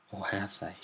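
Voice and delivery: hushed, low-key suspense; ominous teaser. Acted. Storyteller hints at a coming threat, hushed and ominous. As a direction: drop it soft, as a sinister question.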